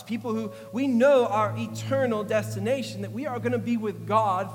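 loud, high, rising preaching. Acted. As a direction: build it up like a revival preacher. Preaching raised voice.

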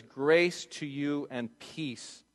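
Grace to you and peace, drawn out slow and soft; slow tender blessing. Shown as a demonstration. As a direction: savor each word like a blessing. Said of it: "He says this at the end".

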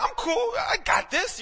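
very high, loud mock confidence; squeaky bravado. Preacher retells facing the bully's big brother, high-pitched bravado.

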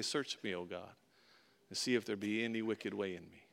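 hushed, reverent prayer; quiet prayer. Retold or imitated. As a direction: pray it softly. Quoting a prayer softly.

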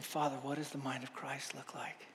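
a whispered prayer. Retold or imitated. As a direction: whisper the prayer under your breath. Silent prayer during car buying.

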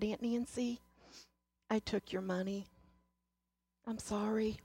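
put-on soft, hushed child's apology; aunt voicing her crying niece's hushed apology; tearful confession.

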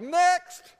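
high, loud summons; calling next. Acted. Moses dismissing a villager's case, high loud call. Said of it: "Moses is like".

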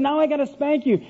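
father at his wits' end, pitch way up; exasperated. Retold or imitated. Father to his wailing son, thoroughly exasperated.

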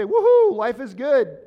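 a sudden high, loud "Woohoo"; mock cheer. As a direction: cheer like nothing can go wrong. Mock carefree cheer, life going great.

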